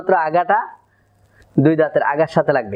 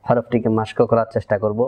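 ordinary talk

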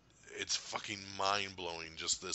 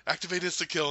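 quoting the suit; 8 semitones higher, 6 dB louder, faster than usual. Retold or imitated. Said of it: "quoting Spider-Man's suit".

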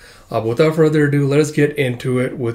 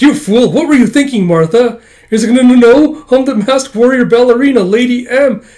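heated shouting; 9 semitones higher, 8 dB louder than usual. Acted. Heated exchange after mask fight.

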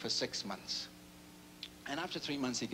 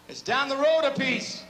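much higher, louder yokel voice with a laugh; hillbilly voice; 13 semitones higher, 12 dB louder than usual. Acted. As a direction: squawk it out like a rustic. Mock answer in a funny voice.

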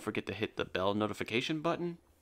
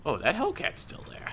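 ordinary delivery

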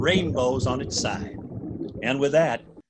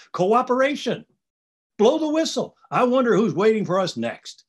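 excited cheer; 7 semitones higher than usual. A narrator's reading. Excited call to move on.

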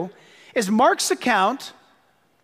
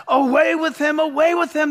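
higher, raised mob cry; upset crowd; 4 semitones higher than usual. Retold or imitated. Preacher voices the furious crowd before Pilate, raised cry. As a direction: shout it as the furious crowd would. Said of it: "They're so upset".

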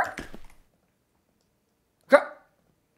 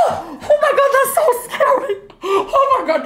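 high, shrieking laughter of fright; laughing scream; 4 dB louder than usual. Real, not acted. Streamer startled by the scary villain, screaming with laughter. Jump scare in horror game.

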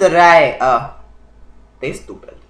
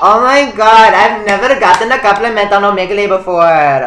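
loud, higher-pitched gush; gushing delight; 4 semitones higher, 7 dB louder than usual. Real, not acted. Vlogger complimented by a stranger on Omegle, gushing loudly. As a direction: react loudly, thrilled by the compliment.

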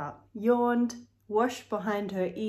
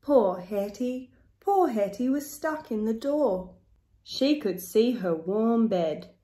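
ordinary delivery

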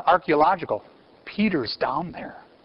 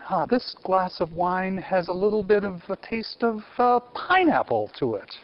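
different imitation; 4 semitones higher than usual. Teacher doing a joking wine-taster impression, higher voice. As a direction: play a fussy wine connoisseur, pitched up. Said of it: "I could do a different imitation".

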